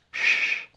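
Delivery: "sh" as a pure sustained hiss of air; sh sound. Shown as a demonstration. Teacher pronouncing the symbol for students, isolated sh. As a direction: say "sh" by itself, without voice. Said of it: "So this one is pronounced".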